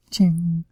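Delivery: high, ringing "ching"; imitated freeze-frame chime. Capping a freeze frame of the mutants, imitated chime. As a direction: sing out a little chime sound effect. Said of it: "it's like a freeze frame of them, like".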